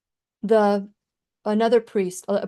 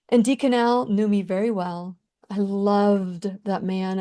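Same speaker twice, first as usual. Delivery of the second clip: ordinary talk, nothing marked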